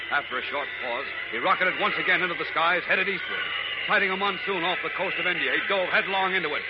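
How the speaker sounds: radio announcer. Acted. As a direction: narrate the chase with urgency. Announcer narrates action over music.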